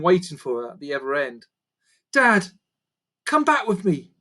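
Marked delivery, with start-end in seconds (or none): 2.1-4.1 put-on high, begging girl's voice; pleading. A narrator's reading. Daughter pleads with her father to come home, begging. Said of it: "Lucy pleaded".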